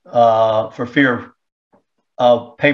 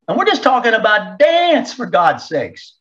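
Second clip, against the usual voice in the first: exasperated; 8 semitones higher than usual. Real, not acted. Exasperated at the dance curriculum.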